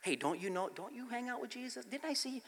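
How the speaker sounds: soft, high-pitched curious questioning; young girl. Retold or imitated. Young girl questioning Peter about Jesus, soft girlish voice. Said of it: "a young girl comes up to Peter".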